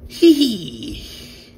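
high-pitched "hee hee"; high playful giggle. Pretend. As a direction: squeal a little mischievous giggle. Playing with the Carnage figure.

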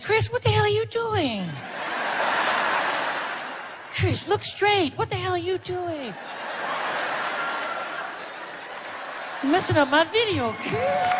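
very high, breathy falsetto scolding. Retold or imitated. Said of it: "[name] was getting mad".

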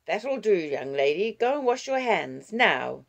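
loud, firm telling-off; scolding. Acted. Grandmother sending the child to wash, scolding. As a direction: order the child off sternly, dropping on the last word.

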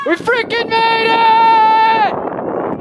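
high, loud triumphant yell; excited shout. Real, not acted. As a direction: scream it out in victory. Reaching the volcano summit.

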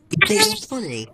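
high sarcastic echo of the same question; squeaky mocking repeat. Real, not acted. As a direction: mimic it back in a silly high voice. Scolding the game baby.

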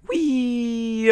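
high, loud held squeal; playful long whoop; gleeful whee. Real, not acted. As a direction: cry out as on a ride. Gleeful cry during game ride.

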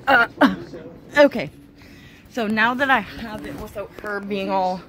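ordinary delivery

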